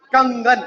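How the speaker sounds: कंगन with a nasal 'ng' before the ग; अनुस्वार सहित उच्चारण. Shown as a demonstration. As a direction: sound the anusvara through the nose in कंगन. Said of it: "इसके ऊपर ये बिंदु लगा दिया". (कंगन, नाक से 'ङ्' की ध्वनि के साथ)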